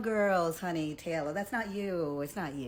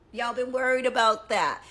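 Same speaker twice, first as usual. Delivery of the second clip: higher, louder taunt; 5 semitones higher, 7 dB louder than usual. Real, not acted. Taunting critics, loud and higher. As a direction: call it out to them. Loud repeat after whisper.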